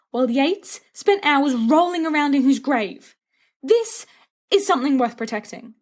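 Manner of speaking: lightly voiced Remus, fervent and coaxing; Remus pleading. A narrator's reading. Remus lectures Sirius on the couple.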